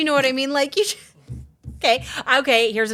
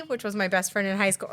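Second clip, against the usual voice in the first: ordinary delivery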